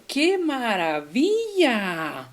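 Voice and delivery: slow, drawn-out ¡qué maravilla!; delighted exclamation. Shown as a demonstration. Teacher showing a long exclamation, delighted. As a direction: marvel at it, stretching each word. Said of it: "expresión larga".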